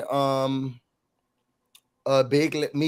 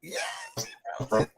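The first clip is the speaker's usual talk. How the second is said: ordinary talk, nothing marked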